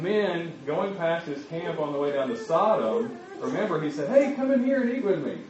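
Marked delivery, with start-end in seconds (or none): 4.1-5.4 high-pitched hail to passers-by; acted high, welcoming call; calling out invitation. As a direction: call strangers over, voice pitched way up. Abraham invites passing men in.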